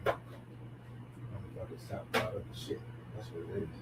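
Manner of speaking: through a wall; muffled. Real, not acted. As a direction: voice muffled and distant, hard to hear. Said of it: "You sound muffled".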